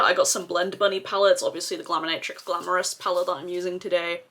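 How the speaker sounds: ordinary delivery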